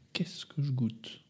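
soft slow whisper. Acted. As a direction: whisper it as a confession. Whispered confession.

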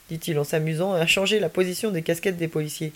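ordinary delivery, nothing marked

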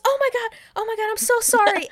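hammed-up hysterical sorry; panicked high-pitched flamboyant host; frantic Ruby voice. Ruby's shrill apology after shooting.

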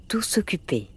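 ordinary delivery

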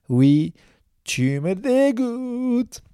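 high, slow, stretched disgust; drawn-out disgust. Acted. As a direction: drag out the disgust, teasing. Mock answer to drunk.

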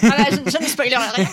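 a real laugh through the refusal; laughing while promising not to spoil. Real, not acted. Joking refusal to spoil ending.

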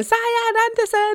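high, drawn-out radio ident; drawn-out kid-style name call; imitating son's ident. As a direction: imitate a child calling out a station ident. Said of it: "all Elijah saying".

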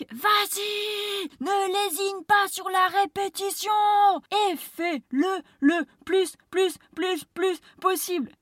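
high, loud excited shout; carried away. Real, not acted. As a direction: get swept up and shout it. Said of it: "Ok, je me calme". (emballé, presque crié)

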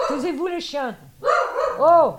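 high-pitched mock yelling at dogs; imitated scolding. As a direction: act out someone hushing noisy dogs, voice jumping way up. Man on phone scolds barking dogs. (imitation aiguë de quelqu'un qui gronde des chiens)

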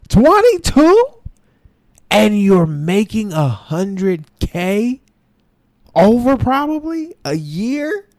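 high, slow, laughing disbelief; incredulous. Real, not acted. To viewers about a young guy's salary: incredulous, high and slow. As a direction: stretch it out in amazed disbelief.

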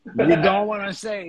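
loud, drawn-out mock English accent; imitation d'accent anglais. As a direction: stretch it out in a put-on English accent. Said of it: "parler anglais, s'il te plaît, frérot, avec l'accent". (accent anglais imité, fort et traînant)

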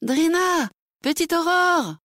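high, ringing call into the distance. Acted. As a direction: call her name across the mountains. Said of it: "On cria son nom aux échos".

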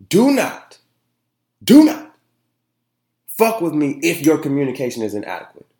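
high, loud retold warning, hammered twice; emphatic warning. Host quoting his warning to entrepreneurs, high and loud. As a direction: lay down the law to flaky entrepreneurs. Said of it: "I specifically said".

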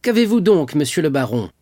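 startled high cry to the baron; king bursts out high and loud. A narrator's reading. King cries out to the baron, startled and loud. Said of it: "s'écria-t-il".

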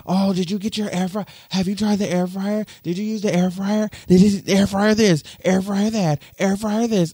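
high, loud pestering mimicry; excited friends mocked. Mocking the Grand Rapids crowd pushing air fryers, high loud mimicry. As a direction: parrot the hype mockingly. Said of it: "everybody in Grand Rapids".

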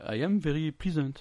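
English in a thick French accent. Real, not acted. Translating a phrase for his friend, French-accented English. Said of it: "qui a pas l'accent de François Hollande".

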